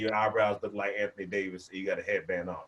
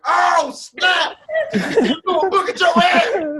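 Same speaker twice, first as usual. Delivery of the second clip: shrieking, much higher and louder; hyped kids shouting; 11 dB louder, slower than usual. Retold or imitated. Kids shouting at a classmate hit by a book, hyped. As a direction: yell it like excited schoolkids. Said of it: "so they were like".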